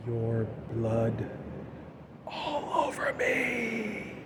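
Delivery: slow sinister whisper into the phone; creepy killer whisper. Retold or imitated. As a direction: breathe the words like a killer on the phone. Killer's chilling answer.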